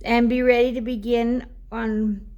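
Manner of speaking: card reading to viewers; oracle card reading. Acted. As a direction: read the guidebook aloud like a gentle message. Reads the oracle card text.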